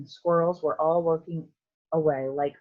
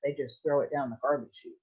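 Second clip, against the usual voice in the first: ordinary delivery, nothing marked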